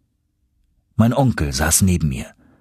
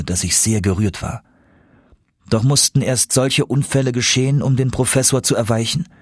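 usual speaking voice

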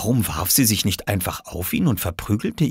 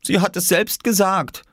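put-on high childlike insistence; high-pitched defensive kid; boy defending himself; 12 semitones higher than usual. A narrator's reading. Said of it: "verteidigte sich Oskar".

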